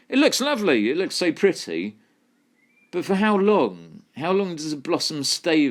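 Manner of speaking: mock cooing. Mock admiring blossom then doubt.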